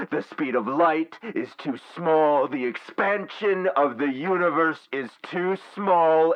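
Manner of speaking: high, loud ranting that keeps climbing; megalomaniac fury. Acted. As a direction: rage at a universe too small for you. AI rages that all is too small.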